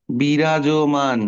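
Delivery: বিরাজমান stretched out very slowly, bi-raj-man; slow model word. Shown as a demonstration. Teacher slowly models বিরাজমান for the class. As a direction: spell বিরাজমান out with the voice. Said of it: "পড়ো, পড়ো।".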